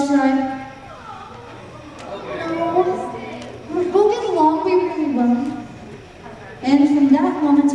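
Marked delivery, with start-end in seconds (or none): 2.5-5.4 pleading chorus. Acted. Animals beg to stay.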